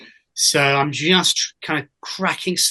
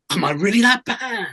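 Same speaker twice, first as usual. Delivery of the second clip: high-pitched mock dismay; acted self-doubt; 5 semitones higher than usual. Insecure actor questioning himself on stage, mock dismay. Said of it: "that moment of".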